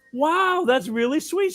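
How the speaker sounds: high-pitched mock admiration; indulgent old lady. Old lady praising the trick-or-treater, high and indulgent. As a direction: pitch it up, humouring a child.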